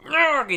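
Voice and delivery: loud, high frustrated roar; an exasperated battle cry; frustrated yell. Retold or imitated. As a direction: let out a furious growl of frustration. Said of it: "very frustratedly goes".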